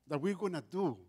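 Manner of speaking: ordinary talk, nothing marked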